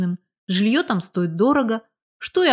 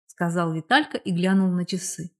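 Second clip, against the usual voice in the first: plain reading voice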